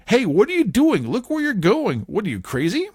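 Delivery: high, loud mock scold. Other man scolds Mickey for the crash, high and loud. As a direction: chew him out for bad steering. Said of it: "The other one is saying".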